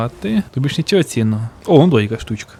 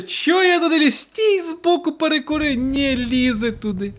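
exasperated self-scolding; 13 semitones higher than usual. Real, not acted. Scolding himself after dying.

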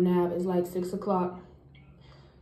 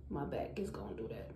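hushed, low whispered complaint; whispering; 10 semitones lower, 13 dB quieter, faster than usual. Real, not acted. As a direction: drop to a whisper.

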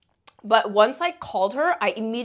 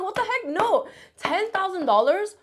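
high-pitched mock outrage; indignant refusal; 10 semitones higher than usual. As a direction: hold firm in a high, incredulous voice. Retells refusing the haggling.

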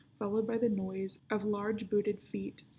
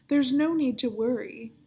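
louder booming villain voice; 3 semitones higher, 8 dB louder than usual. A narrator's reading. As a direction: boom it across the hall. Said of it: "the booming voice of Eels".

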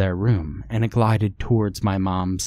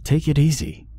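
take it easy; 7 semitones higher than usual. Acted. Marina urging her shouting friend to calm down, raised voice. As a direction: cut in to settle her down.

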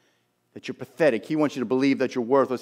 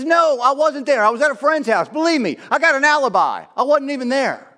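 high, loud, insistent protest; loud panicked denial; 11 semitones higher, 5 dB louder than usual. Acted. Peter denying he was with Jesus, loud insistent denial. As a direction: deny it hard, pitch pushed right up. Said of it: "And he says".

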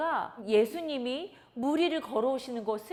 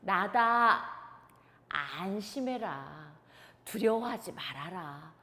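lower, slow, soothing command; calm the frightened men; calm reassurance; 6 semitones lower, slower than usual. Acted. Jesus calming his terrified disciples, reassuring. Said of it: "예수님께서 이렇게 말씀하시는".

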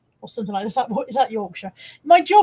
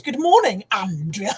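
odd voice; 3 semitones lower, 4 dB louder, slower than usual. Shown as a demonstration. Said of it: "I didn't mean to say that like that".

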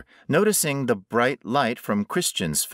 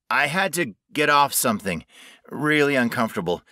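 hurried reassuring; 7 semitones higher, 3 dB louder, faster than usual. A narrator's reading.

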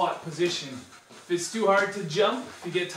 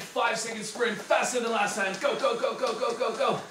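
urgent go calls; 5 semitones higher than usual. Acted. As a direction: hype the sprint. Instructor urging sprint.

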